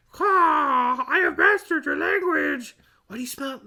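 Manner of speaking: high squawking bird voice; high cartoon parrot speech; talking parrot. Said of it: "you're speaking full sentences".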